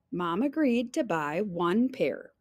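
ordinary delivery

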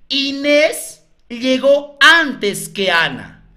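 slow, each word spaced out; slow dictation. Shown as a demonstration. Teacher dictating a clue to colleagues, slowly. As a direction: spell it out slowly so they can copy.

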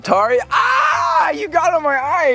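high yelp of pain; pained shout. Real, not acted. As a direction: cry out as it stings. Spray in his eye.

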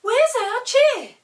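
high, slow goblin squeak; a shrill little goblin asking; high slow goblin demanding his chair. A narrator's reading. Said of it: "said the goblin".

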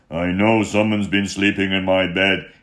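loud booming gruff bear voice; Papa Bear swearing. Acted. Papa Bear finding his slept-in bed, loud booming voice. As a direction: growl it out like an angry father bear. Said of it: "he swore".

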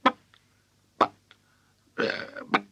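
halting, broken stutter; stammering. A narrator's reading. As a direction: stammer in shock with gaps between words. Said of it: "stammered George's mother".

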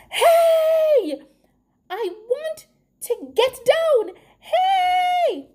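high, loud calling from afar; loud shout. A narrator's reading. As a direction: holler out high, stretching the Hey. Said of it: "a loud voice shouted".

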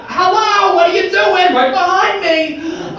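high, loud, drawn-out mock nagging; funny naysayer voice. Nervous inner voice as governor pops up, high comic squawk. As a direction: squawk it in a comic Bronx accent. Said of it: "in a funny accent".

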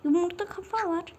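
ordinary talk, nothing marked

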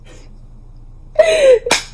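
high-pitched burst of laughter. Real, not acted. Vlogger signing off after a reaction, laughing.

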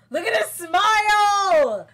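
very high, loud, slow squeal ending in a laugh; delighted shout. Real, not acted. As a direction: scream it, totally smitten. Gushing over the teacher.

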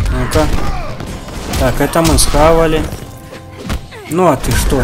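ordinary talk, nothing marked

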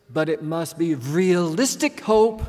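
put-on German-accented warning, emphatic and measured; heavy German accent. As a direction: imitate her heavy German accent, leaning on each word. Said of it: "in her heavy German accent".

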